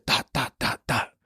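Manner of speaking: whispered pop-pop of gunfire; voiceless mimicked gunshots; imitated gunshots. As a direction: breathe out the shots like distant gunfire. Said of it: "and you hear".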